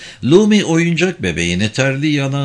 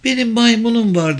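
put-on high-pitched little child's voice; small child; 11 semitones higher, 3 dB louder than usual. A narrator's reading. Child in the back seat tells of a monkey, piping up. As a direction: pipe up much higher, like a child.

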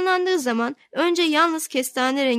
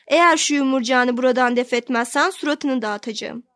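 ordinary delivery, nothing marked

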